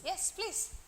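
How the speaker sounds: ordinary talk, nothing marked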